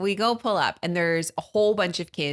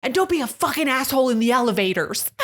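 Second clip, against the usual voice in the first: imitating a yell; 8 semitones higher, 5 dB louder than usual. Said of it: "she's like".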